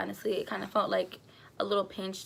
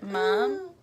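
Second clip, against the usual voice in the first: calling mom; 9 semitones higher than usual. Retold or imitated. Calling her mom, water broke.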